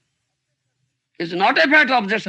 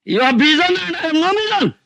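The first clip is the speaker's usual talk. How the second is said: high, fast mocking echo of the student; mocking mimicry; 9 semitones higher, 3 dB louder, faster than usual. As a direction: parrot his claim back, quick and high. Teacher mocks the student's claim.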